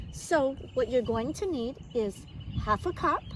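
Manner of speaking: ordinary delivery, nothing marked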